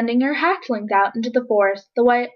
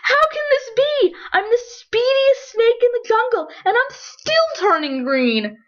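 very high, panicky snake voice; dismayed; 13 semitones higher than usual. A narrator's reading. Verdi horrified at turning green, high and panicked. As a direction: squeak out the disbelief, slowing at the end. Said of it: "he gasped".

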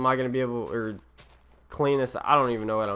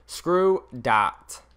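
odd voice; 3 dB louder than usual. Real, not acted. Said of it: "I don't know why I said it like that".

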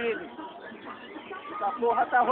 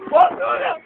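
higher frightened yell; scared cry; 9 semitones higher, 4 dB louder than usual. Real, not acted. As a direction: cry out at the height. Scared of the height. (grito de medo)

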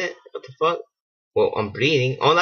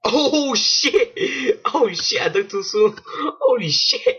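higher, startled cries of alarm; panicked yelp; 10 semitones higher, slower than usual. Real, not acted. Sudden danger in game.

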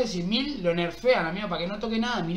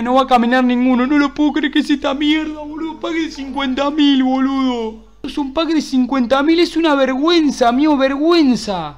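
loud, outraged complaint; indignant rant; 6 dB louder than usual. Real, not acted. Streamer rages at a shameful fifty-thousand pack, indignant. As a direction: protest loudly at being ripped off. Protests a bad expensive pack.

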